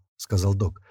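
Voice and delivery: usual speaking voice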